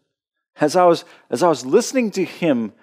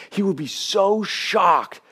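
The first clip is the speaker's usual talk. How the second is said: higher, louder, building preacher's cry; sermon voice climbing in intensity; preaching at full force; 4 semitones higher, 5 dB louder than usual. Acted. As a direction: lift the room as you preach.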